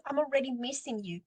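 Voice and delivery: ordinary talk, nothing marked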